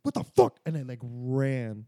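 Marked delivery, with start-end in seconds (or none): 0.0-0.6 high startled shriek on waking. Retold or imitated. Startled awake by the cat landing on his face, shrieking. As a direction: yelp it like you've just been jolted awake. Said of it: "I woke up like".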